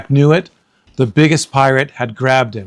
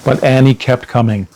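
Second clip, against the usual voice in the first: plain reading voice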